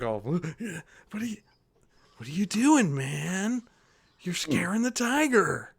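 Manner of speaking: half-laughing hippie, mildly put out; stoner dude. Acted. GM voices Mick again.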